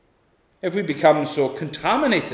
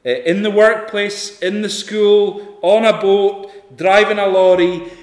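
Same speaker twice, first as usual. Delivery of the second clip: louder, higher fervent sermon delivery; preaching at full force; 6 semitones higher, 8 dB louder than usual. Acted. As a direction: drive it home to the congregation. Preaching builds to loud fervour.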